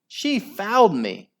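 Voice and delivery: high, indignant squeal; shriek. A narrator's reading. Henry complaining of a foul, shrieking. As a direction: squeal in outrage. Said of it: "shrieked Henry".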